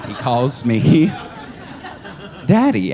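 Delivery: ordinary delivery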